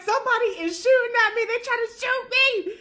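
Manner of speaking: high, fast frightened outburst; panicked blurt. Retold or imitated. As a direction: blurt it out in a mock panic, pitch way up. Panicked telling the older gentleman.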